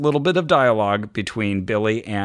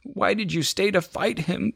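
higher, a touch of tearful pleading; lightly voiced sobbing Billy; mild sobbing boy; 10 semitones higher, 4 dB quieter than usual. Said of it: "Billy sobbed".

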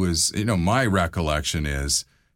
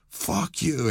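retold furious swearing, pitched up; 11 semitones higher than usual. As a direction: imitate an angry rock star blowing up, volume held back. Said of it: "he just lost his shit".

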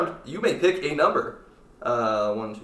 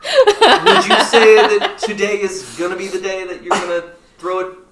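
teasing, louder, laughing; playful prompt; 4 semitones higher, 7 dB louder than usual. Real, not acted. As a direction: nudge her toward the sign-off with a grin. Host playfully prompts sign-off.

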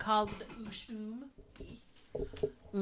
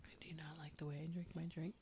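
whispering; 6 semitones lower, 13 dB quieter, faster than usual. Acted. Mock ASMR into the mic.

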